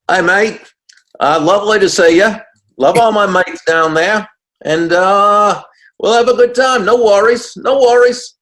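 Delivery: loud, high-pitched put-on Aussie accent. Shown as a demonstration. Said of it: "give me your favorite Aussie accent".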